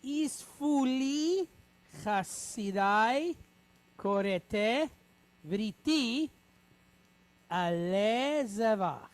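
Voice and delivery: slow, word-by-word recitation; slow read-aloud. Shown as a demonstration. Teacher reading the Hebrew sentence slowly with students. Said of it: "So let's read it together".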